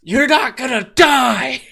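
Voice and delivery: screamed at the top of the lungs, slow and high; frantic shout of refusal; desperate shout. Acted. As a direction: yell it to keep a dying friend alive. Acted rescue shout in play.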